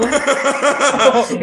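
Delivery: high burst of laughter; loud laugh. Real, not acted. Reacting to his friend's joke, loud laugh. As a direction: crack up out loud.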